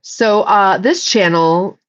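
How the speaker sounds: ordinary talk, nothing marked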